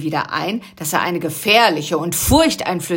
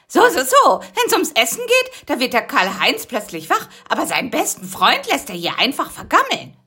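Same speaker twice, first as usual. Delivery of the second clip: high teasing little voice; 7 semitones higher than usual. Acted. Busy teasing the just-woken Karl-Heinz, high and mocking. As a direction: needle him playfully. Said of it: "stichelte Busy".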